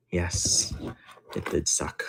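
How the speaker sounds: breathy whispered yes. Real, not acted. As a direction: whisper it to the chat. Quiet reply to chat.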